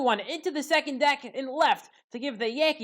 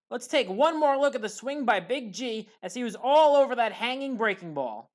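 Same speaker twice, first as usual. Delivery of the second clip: usual speaking voice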